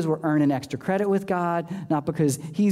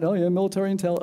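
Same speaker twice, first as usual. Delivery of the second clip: ordinary talk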